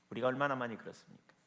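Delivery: ordinary delivery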